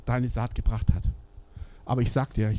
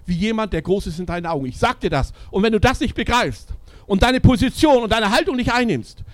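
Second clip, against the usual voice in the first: rising preaching; 7 semitones higher, 7 dB louder than usual. Acted. Preacher assuring each listener.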